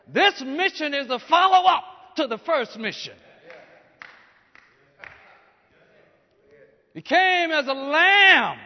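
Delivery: loud, rising preacher's build to a climax; preaching at full force. Acted.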